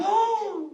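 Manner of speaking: high gasp of realisation; sudden gasp. Acted. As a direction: gasp as the bear suddenly remembers. Bear suddenly remembers the hat.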